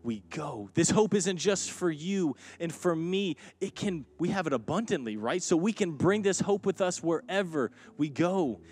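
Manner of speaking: rally the congregation with rising fervor; fervent preaching. Acted. Preaching at full force.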